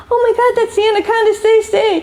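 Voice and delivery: high, rapid excited cry; urgent alarm. Retold or imitated. Researcher warns colleague standing on the anaconda, urgent alarm. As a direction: imitate a panicked urgent warning.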